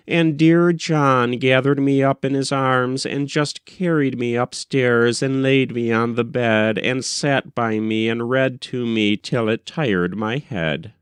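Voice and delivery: ordinary delivery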